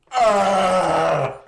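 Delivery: long, loud, high yell; hammed-up wild holler; mock roar. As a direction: let out a drawn-out lumberjack yell. Imitates the lumberjack's yell.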